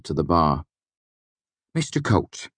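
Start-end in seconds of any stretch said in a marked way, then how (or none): 1.7-2.5 eager, higher call; a bright, eager higher voice; excitedly. A narrator's reading. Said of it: "he said excitedly".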